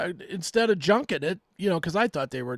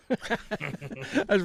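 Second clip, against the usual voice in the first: laughing. Real, not acted. Laughing at own drinking.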